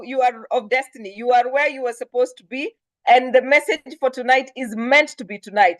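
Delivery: ordinary talk, nothing marked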